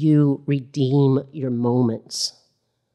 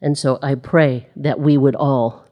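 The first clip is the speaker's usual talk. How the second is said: normal talking voice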